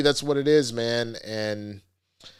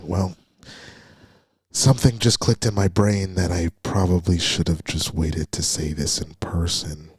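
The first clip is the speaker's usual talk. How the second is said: mock creepy voicemail; 5 semitones lower, 3 dB louder than usual. Mocking a creepy guy leaving a late-night voice message, low mock voice.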